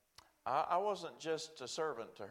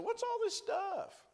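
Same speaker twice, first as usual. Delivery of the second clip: much higher and slow, puzzled; baffled attorney; slower than usual. Retold or imitated. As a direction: ask it like he can't believe the mess.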